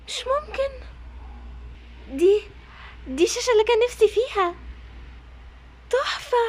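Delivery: high breathless amazement; delighted gasp. Acted. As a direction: gasp at the surprise gift. Surprised by the new TV. (دهشة وفرحة عالية)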